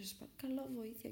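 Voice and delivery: softly re-enacted cry for help; retold desperate plea. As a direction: imitate begging softly for help.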